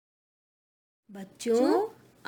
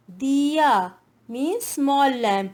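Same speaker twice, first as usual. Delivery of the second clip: slow teacher read; 4 dB louder, much slower than usual. Shown as a demonstration. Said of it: "teacher explaining word slowly".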